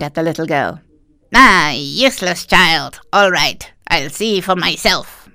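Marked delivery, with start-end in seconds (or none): 1.3-5.3 angry witch. Acted. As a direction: snap at the useless girl. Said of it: "Angrily, the witch screamed".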